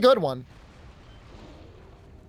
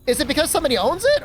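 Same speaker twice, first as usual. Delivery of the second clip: voice cracking up an octave; 8 semitones higher, 4 dB louder, faster than usual. Real, not acted. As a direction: voice cracks high mid-question. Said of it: "My voice just cracked".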